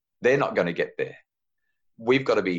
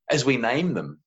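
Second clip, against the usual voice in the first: ordinary talk, nothing marked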